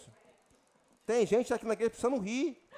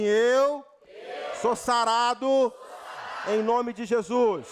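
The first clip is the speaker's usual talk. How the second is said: loud declaration; 2 semitones higher, 4 dB louder, slower than usual. Shown as a demonstration. Preacher leading the church to declare healing. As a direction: proclaim it for all to repeat. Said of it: "Diga assim".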